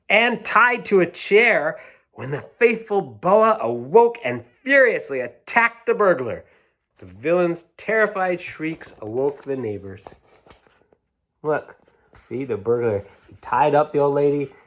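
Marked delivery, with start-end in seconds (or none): 0.1-10.1 higher, excited storybook narration; dramatic read-aloud; reading to children. A narrator's reading. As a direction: make the rescue thrilling for a child. Reads picture book aloud.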